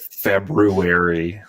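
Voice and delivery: February drawn out as FEB-ROO-air-ee; R pronounced slowly. Shown as a demonstration. Co-host proving the R to guest, slow careful February. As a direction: say February slowly, sounding the R after the B. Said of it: "You pronounce the R".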